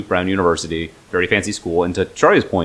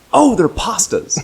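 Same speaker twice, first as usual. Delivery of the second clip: high, slow, clueless mock voice; mock naive guess; 14 semitones higher, slower than usual. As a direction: play it dumb, wide-eyed. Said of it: "You're like".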